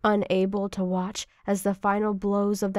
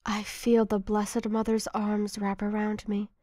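plain reading voice

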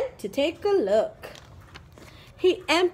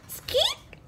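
very high, soft mouse squeak; tiny high squeak; 4 dB quieter than usual. Acted.

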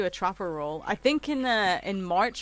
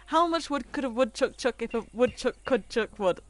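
quick-fire tongue twister, a bit higher; fast tongue twister; 4 semitones higher than usual. Shown as a demonstration. Streamer having another go at the tongue twister, rapid-fire. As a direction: rattle it off without stopping. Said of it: "I can't say that fast".

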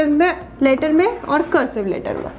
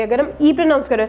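ordinary talk, nothing marked

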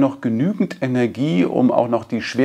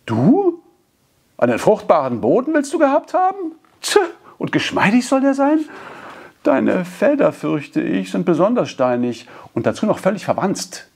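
hissing mockery; 10 semitones higher than usual. A narrator's reading. Washerwoman sneering at a boasting neighbour, high and cutting. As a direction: scoff at her rival's boast. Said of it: "zischte eine Frau".

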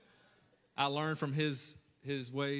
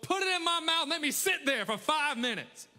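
high, loud, hammed-up protest; mock outrage; 12 semitones higher, 5 dB louder than usual. Son retelling mom's soap punishment, exaggerated outrage. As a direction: wail the grievance out at full volume.

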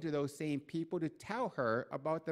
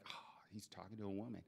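mock astonished murmur; 6 semitones lower, 14 dB quieter than usual. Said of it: "They're like".

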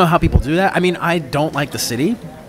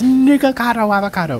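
mock sign shout; 8 semitones higher than usual. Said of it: "holding up a sign that says".

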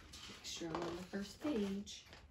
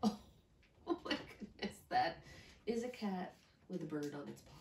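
ordinary delivery

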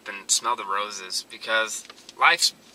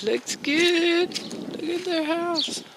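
higher, playful admiring voice; mock-sweet, higher admiring tone; 4 dB quieter, much slower than usual. Real, not acted. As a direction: gush over the new house. Admiring the new house.

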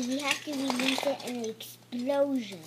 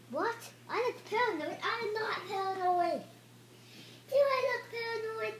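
indignant; 7 semitones higher, faster than usual. Acted. Indignant denial.

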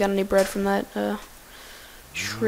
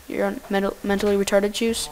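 normal talking voice

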